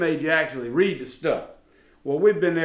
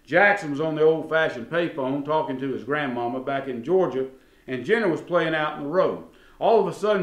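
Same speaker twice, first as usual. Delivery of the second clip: normal talking voice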